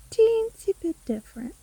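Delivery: high, mock-cute squeak on teensy; teasing witch. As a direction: tease them in a high little voice. Witch teases about the deal.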